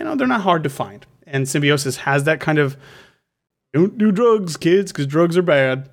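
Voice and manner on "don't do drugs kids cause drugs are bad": badly faked officer preaching at kids; higher-pitched mock anti-drug lecture; Lieutenant Yar. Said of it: "the worst Lieutenant Yar impression I've ever heard".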